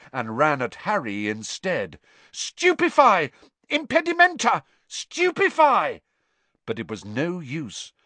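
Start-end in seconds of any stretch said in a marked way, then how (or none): 2.3-6.0 high, loud burst of spells; yelled spells. A narrator's reading. Harry firing spells at the charging spider, shouting. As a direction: fire spell after spell in panic.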